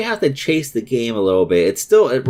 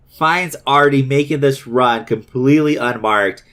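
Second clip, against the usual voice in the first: normal talking voice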